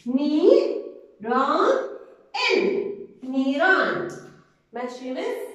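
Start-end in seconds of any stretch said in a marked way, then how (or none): 0.1-4.1 each syllable called out loud and separate, then the whole word slowly; loud, slow syllable-by-syllable reading for the class. Shown as a demonstration. Teacher to young pupils, loud slow syllable reading. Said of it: "زي ما بقرأ بدي أحلل".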